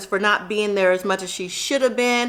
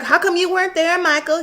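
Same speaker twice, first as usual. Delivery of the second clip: Sarah impression; 6 semitones higher than usual. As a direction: voice Sarah asking her son.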